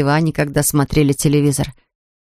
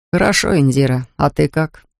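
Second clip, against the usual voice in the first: usual speaking voice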